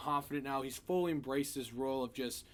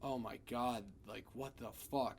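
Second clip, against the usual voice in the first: stunned shock; 3 semitones lower, 6 dB quieter than usual. Retold or imitated. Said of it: "utter shock".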